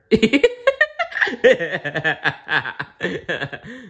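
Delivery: hearty laugh. Real, not acted. Narrator laughs at end.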